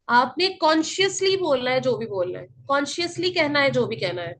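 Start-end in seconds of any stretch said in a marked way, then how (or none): none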